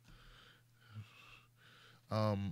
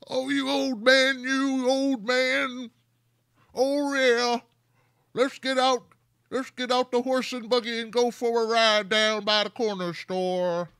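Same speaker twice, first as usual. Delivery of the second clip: mock old-timer; 7 semitones higher, slower than usual. Mocking the man as old-timey.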